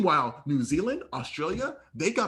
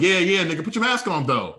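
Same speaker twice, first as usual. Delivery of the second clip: louder, insistent retold back-talk; acted impatient comeback, raised voice; dismissive comeback; 3 semitones higher, 7 dB louder than usual. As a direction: brush off his attitude and repeat the order. Talking back to a screaming stranger.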